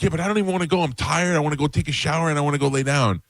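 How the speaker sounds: put-on high complaining teen voice; dad mimicking daughter's complaints; whiny teen. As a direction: whine like a tired teenager. Daughter complaining she's tired.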